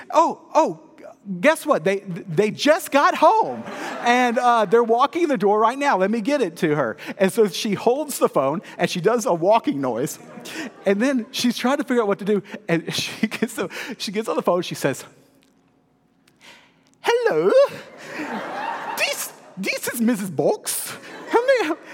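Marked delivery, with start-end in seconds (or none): 0.1-6.9 higher, louder, mock-excited mom; acted flustered excitement. Mom improvising a fib to the caller, flustered. Acts out mom's flustered cover story. 17.0-21.8 high-pitched, hammed-up fake British voice; very bad British accent. Mom posing as Missus Box on the phone, terrible British accent. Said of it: "perfect impression of her very bad British".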